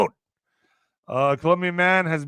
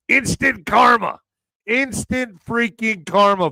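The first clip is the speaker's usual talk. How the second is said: gleeful emphasis; 6 semitones higher, 4 dB louder, slower than usual. Real, not acted. Host's gleeful slow punchline.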